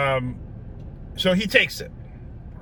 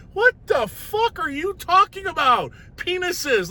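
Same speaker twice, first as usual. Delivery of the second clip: very high, incredulous outburst; aghast disbelief; 13 semitones higher than usual. Retold or imitated. Patient on the table overhearing doctor, aghast high outburst. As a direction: squeal it in appalled disbelief.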